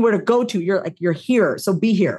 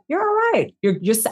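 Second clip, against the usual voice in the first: much higher, soothing reassurance; reassuring inner voice; 7 semitones higher than usual. Retold or imitated. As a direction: comfort yourself gently. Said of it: "That's the voice that I have with myself".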